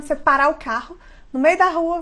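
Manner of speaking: ordinary talk, nothing marked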